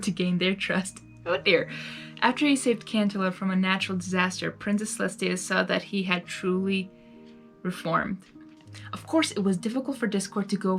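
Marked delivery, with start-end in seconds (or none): none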